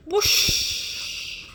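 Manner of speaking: high loud breathy whoosh; whoosh effect. A narrator's reading. Narrator sounding the club hitting T-Rex, loud breathy whoosh. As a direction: make the swish of the club.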